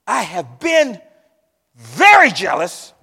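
loud, drawn-out self-pitying cry; loud whining complaint. Acted. Elijah complaining to God, a loud self-pitying wail. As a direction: belt it like a sulking prophet.

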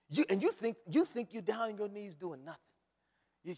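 usual speaking voice

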